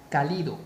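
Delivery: cálido said as ca-LI-do; stress on middle syllable. Shown as a demonstration. Teacher showing a wrong stress, cálido as ca-LI-do. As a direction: move the stress in cálido onto LI. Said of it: "si la mayor fuerza de voz estuviese en li".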